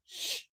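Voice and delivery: soft drawn-out shh; quiet shushing sound; shushing hiss. Acted. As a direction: hush them with a shh. Hushing about secrets.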